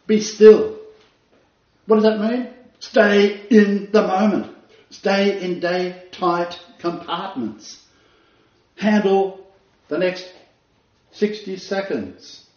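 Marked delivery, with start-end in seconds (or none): 0.1-7.8 loud, high, slow preacher emphasis; hammered-out preacher lines; emphatic preaching. Acted. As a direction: drive each phrase home slowly. Preaching the stillness.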